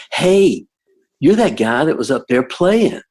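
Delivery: put-on bright-eyed kid calling out to the guitar guy; excited teenager voice. Teen fan meets musician in hallway.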